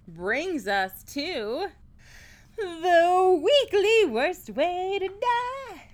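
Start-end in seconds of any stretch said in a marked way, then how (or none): 2.6-5.8 slow, louder dramatic segment intro; segment announcement. Acted. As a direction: announce it like a show host. Announcing podcast segment title.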